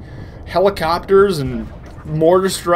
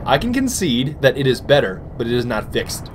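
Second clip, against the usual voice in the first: usual speaking voice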